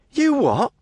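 put-on high, affronted young woman; indignant lass.

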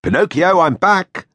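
father announcing he's home; calling out. A narrator's reading. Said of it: "Geppetto call from outside".

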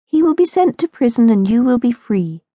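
put-on high, confident girl's voice; a fearless friend pointing out the bright side; bold imaginary friend Mary. A narrator's reading. Mary reassures Lucinda, high and sure. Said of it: "pointed out the imaginary Mary".

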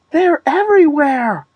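high, alarmed exclamation; panicked cry. A narrator's reading. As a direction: cry out as the spiders close in. Panicked at spiders all around.

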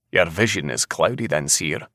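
plain reading voice

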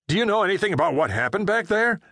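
fast, high frightened questioning; panicked. A narrator's reading. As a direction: blurt it out in a panic. Panicked driver after explosion.

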